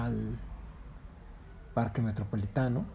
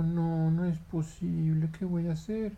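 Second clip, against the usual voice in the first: mild despair; 7 semitones higher than usual. Acted. Monkey despairs.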